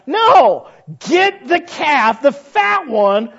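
loud, high and slow insistence; preacher shouting the command; emphatic shout. Acted. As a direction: hammer home the fat calf.

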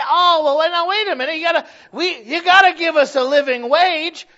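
preacher mimicking outraged wage demands; mocking whiny protest. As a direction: parody the complainers with high indignation. Mocking people demanding living wage.

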